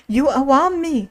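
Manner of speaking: a touch of kingly alarm. A narrator's reading. King to his wild-eyed messenger, mildly alarmed.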